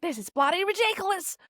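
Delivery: high-pitched mock Australian tourist kicking off; outraged Australian accent. Tourist protesting about cancelled trains, mock-outraged Australian accent. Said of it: "they're all just kicking off".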